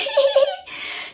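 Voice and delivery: high giddy giggle as Sarah; a bashful, giddy laugh. Retold or imitated. Sarah giggling in the confessional, high giddy laugh.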